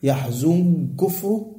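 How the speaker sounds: "يحزن كفره" slowly, the nun run into the kaf so it sounds awkward; slow clumsy merge in "يحزن كفره"; heavy merged demo. Shown as a demonstration. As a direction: show how clumsy "يحزن كفره" gets when merged. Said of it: "يصعب".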